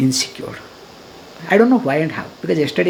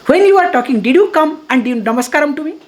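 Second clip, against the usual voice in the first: louder, higher mock scolding; retold angry retort; 5 semitones higher, 4 dB louder, faster than usual. Swami retells confronting a man at a roadblock, indignant retort. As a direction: fire back a challenge, imitating an argument. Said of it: "then of course I shouted".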